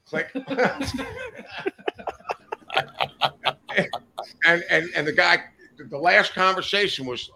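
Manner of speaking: ordinary talk, nothing marked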